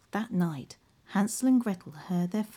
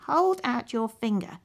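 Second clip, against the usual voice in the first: higher, commanding witch voice; 5 semitones higher, 3 dB louder than usual. Acted. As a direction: order him like a greedy witch.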